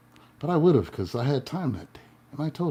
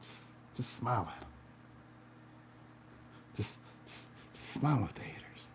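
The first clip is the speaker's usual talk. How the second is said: whisper; 16 dB quieter than usual. Real, not acted. Advice whispered to viewers.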